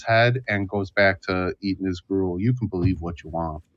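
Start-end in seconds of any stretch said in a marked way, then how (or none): 2.4-3.6 put-on low, soft, quick old-timer brush-off; Cornelius grumbling. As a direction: mutter it and go back to your gruel. Old man shrugging off doubters.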